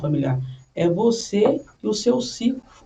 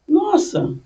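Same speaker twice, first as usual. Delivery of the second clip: vizinha fofoqueira; 8 semitones higher, 3 dB louder than usual. Retold or imitated. Vizinha fofocando, voz imitada.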